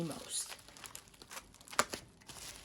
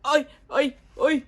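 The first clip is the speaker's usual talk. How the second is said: much louder calls of oi; loud oi exclamations; 5 semitones higher, 11 dB louder than usual. Real, not acted. Fan fights the packaging, loud oi calls. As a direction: shout oi while tearing it open. Struggling then finding the card.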